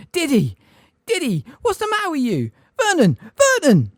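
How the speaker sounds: high, loud panicked shriek for Vernon; a frightened mother calling her husband. A narrator's reading.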